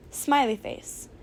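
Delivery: plain reading voice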